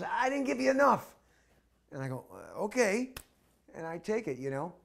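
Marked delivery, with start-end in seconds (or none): 0.0-1.1 pushing more money, pitched up high and loud; a soldier presses more cash on the monk, high and eager. Retold or imitated. 2.3-3.1 surprised okay. Retold or imitated. Narrator accepts money, surprised.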